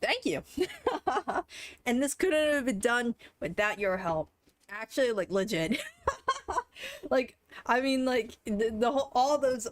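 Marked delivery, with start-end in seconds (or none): 0.5-1.8 breathless squealing laugh; high giddy laugh. Real, not acted. Streamer thanking chat, giddy squealing laugh. 5.9-7.1 very high giddy laugh; squealing laugh. Real, not acted. Streamer thanking donors, squealing laugh.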